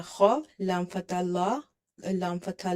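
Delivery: ordinary talk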